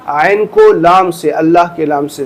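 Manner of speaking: usual speaking voice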